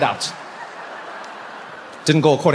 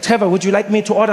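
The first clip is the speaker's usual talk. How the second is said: put-on German-accented friend's offer; 3 semitones higher, faster than usual. Friend offering to order, German accent. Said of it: "he's like".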